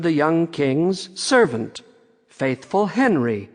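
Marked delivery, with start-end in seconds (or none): none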